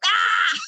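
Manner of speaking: sudden high startled shout; short loud scream of fright; startled yell. Retold or imitated. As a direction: jump out of your skin with a yelp. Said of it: "I yelled".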